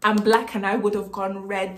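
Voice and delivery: ordinary delivery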